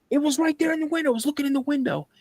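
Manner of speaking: high, rushed, re-enacted panic; panicked insistence. Insisting in panic what he saw.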